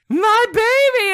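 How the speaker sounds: very high, loud drawn-out wail; hammed-up mother's shriek; retold cry. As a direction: scream it like a mother finding her child. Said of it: "I hear from the side".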